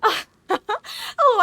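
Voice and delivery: bursts out in a squealing laugh; laughing shriek, high and loud. Real, not acted. Sudden laughing cry on screen.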